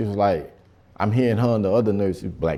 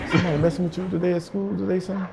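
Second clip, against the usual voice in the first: caring dad voice; 9 semitones higher, 3 dB quieter, faster than usual. Retold or imitated.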